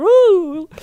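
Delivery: high, loud "ooh" whoop. Acted. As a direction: let out a playful high "ouh". Mock squeal over a delighted fan.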